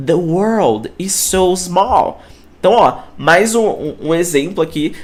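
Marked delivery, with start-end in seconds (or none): 0.1-2.2 The world is so small, very slowly spaced; careful slow model sentence; slow English demo. Shown as a demonstration. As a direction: read each word slowly for learners. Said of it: "ele é muito pequeno".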